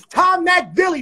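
hyped-up boast shouted at the chat; proud shout. Real, not acted. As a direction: bellow out his own name, slow and drawn. Bragging his own name, shouted.

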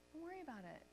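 very soft, soothing captain; quiet reassurance. Retold or imitated. Plane's captain to flustered passenger, quiet reassurance.

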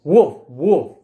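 loud, short woofs; dog barking. Acted. Reader voices the dog for children, barking. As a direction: bark it out like a dog.

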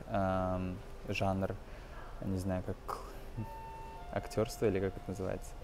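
normal talking voice

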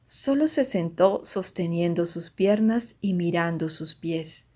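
ordinary delivery, nothing marked

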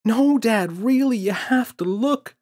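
put-on high, loud pleading child shout. Boy shouting to his distracted father. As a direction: shout at dad to make him look. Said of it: "shouted James".

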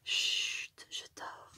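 put-on hushed whisper, as if a child is sleeping. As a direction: breathe it out, barely voiced. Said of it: "je parle tout bas du coup".

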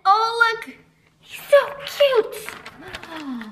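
slow, soft coo over something adorable; cooing cute. Acted. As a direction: melt over the cute fish. Reading picture book to kids.

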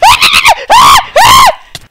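very high, loud screams; wordless squeals as if burning; mock agony shriek. Gamer whose character is on fire, shrieking.

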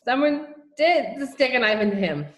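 loud, high, slow hiccupped outburst; hiccupping giggles. A narrator's reading. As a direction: force words out through mock giggle-hiccups. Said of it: "hiccupped Patrick".

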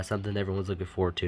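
normal talking voice

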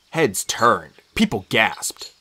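ordinary delivery, nothing marked

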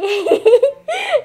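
very high burst of laughter; prankster's laugh. Real, not acted. Prankster after rival quits the game, high gleeful laugh.

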